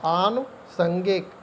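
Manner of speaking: आनुषंगिक spelled out slowly, syllable by syllable; आनुषंगिक said slowly like a teacher dictating; slow and careful. Shown as a demonstration. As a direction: draw out आनुषंगिक for the class. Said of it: "आनुषंगिक होता है सही शब्द".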